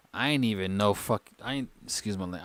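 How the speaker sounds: ordinary talk, nothing marked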